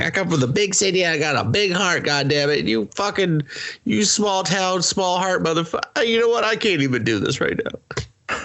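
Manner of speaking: high hammed-up ranting voice; mock city guy rant. City guy cursing small-town folk, high mock rant. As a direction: rant like an offended city boy. Character ranting, acted quote.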